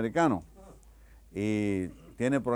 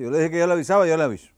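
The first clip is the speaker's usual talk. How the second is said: louder, higher annoyed insistence; irritated coordinator; 7 semitones higher, 11 dB louder, faster than usual. Acted. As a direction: snap at him, fed up and loud.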